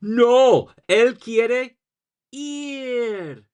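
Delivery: high, dramatic telenovela cry; soap opera star. Shown as a demonstration. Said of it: "Say it like a soap opera star".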